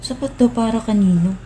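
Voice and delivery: high, rising disbelief; can't believe what she just read; incredulous. Acted. Worker hearing 500 pesos is enough, incredulous and high.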